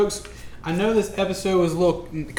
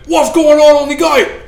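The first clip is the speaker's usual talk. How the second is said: high, loud, alarmed orc voice; shrill-pitched monster yelling over a wall; orc guards calling; 11 semitones higher, 12 dB louder than usual. Acted. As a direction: bark it out like a startled orc at the gate. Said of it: "the orcs you can hear say".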